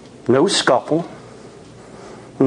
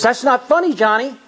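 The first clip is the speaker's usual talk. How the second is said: higher, scared and pleading; frightened plea; 11 semitones higher than usual. A narrator's reading. Laura alone in the car begging Johnny to stop, frightened. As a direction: voice tight with fear. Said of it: "in total fear".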